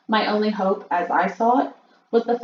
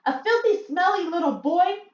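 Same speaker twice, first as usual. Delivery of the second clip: disgusted witches; 11 semitones higher than usual. A narrator's reading.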